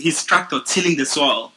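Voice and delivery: ordinary delivery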